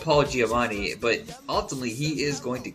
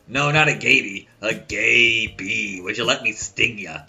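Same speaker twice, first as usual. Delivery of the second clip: Harvey Fierstein voice; 3 semitones lower, 4 dB louder than usual. Retold or imitated. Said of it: "And he goes".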